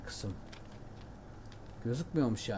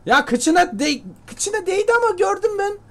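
high, loud excited shout; excited yell; 8 dB louder than usual. Real, not acted. Gamer sees his arrow graze a rabbit, excited yell. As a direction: yell it out, thrilled at the near hit.

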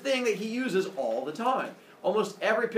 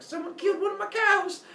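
high, mock-outraged exclamation; outraged cry. Apollo finds the cow guts, outraged. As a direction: wail it high, in comic outrage.

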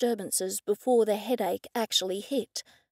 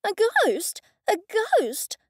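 stammering; 11 semitones higher, much slower than usual. A narrator's reading. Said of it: "I stammered".

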